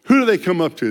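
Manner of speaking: ordinary talk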